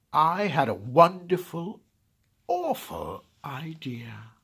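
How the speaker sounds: high, loud wicked glee; exultant villainous grin; gleeful evil delight. Acted. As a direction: savour the wonderful awful idea. Grinch's gleeful idea.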